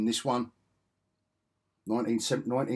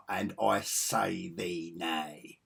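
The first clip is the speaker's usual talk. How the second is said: very slow, lower, portentous refusal; mock-archaic hero; 4 semitones lower, 4 dB quieter, much slower than usual. Fan quoting Thor's old-fashioned refusal, grandly slow. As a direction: draw out each word like a comic-book god. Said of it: "makes you want to talk like that".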